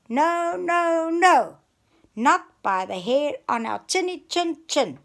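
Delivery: defiant shout. A narrator's reading. Brave pig shouting at the wolf through the door. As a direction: stand firm and shout it. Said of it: "Pete shouted".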